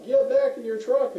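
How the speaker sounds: high, loud, stretched-out warning shout; retold yell. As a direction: holler it as if warning the firefighter. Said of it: "I'm trying to yell at him".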